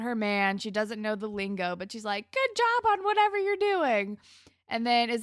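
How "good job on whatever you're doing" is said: much higher, louder, sing-song cheerleader voice; mock cheery girlfriend. Ellie cheering her boyfriend in shop class, high and chirpy. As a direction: chirp it like a clueless supportive girlfriend.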